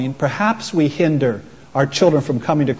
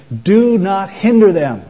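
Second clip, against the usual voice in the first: slow, higher and louder stern command; indignant Jesus; 5 semitones higher, 5 dB louder, slower than usual. Retold or imitated. Preacher voicing Jesus rebuking the disciples, slow and stern. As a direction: lean hard on each word like a rebuke. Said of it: "with equal indignation".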